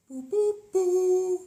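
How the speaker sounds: loud, very high, slow "boo boo boo"; a silly hooting greeting; playful high boos. Acted. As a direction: hoot it out high and loud like a cartoon. Playful noise at stream start.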